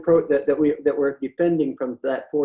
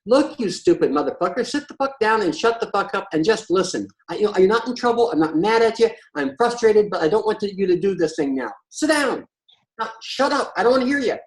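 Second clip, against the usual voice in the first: raised, higher-pitched demo of telling someone off; frustrated orders building to high, sharp commands; exasperated scolding; 5 semitones higher, 4 dB louder than usual. Shown as a demonstration. Speaker showing the harsh way to stop someone, exasperated scolding. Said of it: "But if I say".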